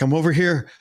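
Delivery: high, pleading call over the walkie; stranded teammate calling out to be rescued; calling for help. Retold or imitated.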